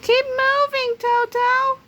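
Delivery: high, loud chick voices calling. Acted. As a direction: call out to him in little voices. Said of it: "the chicks called out".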